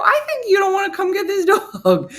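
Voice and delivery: very high, loud mock whine of protest; hammed-up high-pitched complaint; exasperated accusation. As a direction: protest in a squeaky, worked-up pitch. Acted complaint to foster guy.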